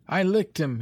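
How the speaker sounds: put-on a boy bragging after a fight; exultant shout. A narrator's reading. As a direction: crow the victory to your friends. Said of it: "he shouted exultantly".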